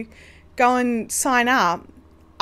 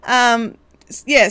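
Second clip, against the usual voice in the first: ordinary talk, nothing marked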